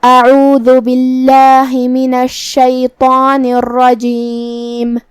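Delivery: slow, melodic tajweed chant; Quran recitation. Acted. Teacher recites Surah An-Naba for listeners, melodic chant.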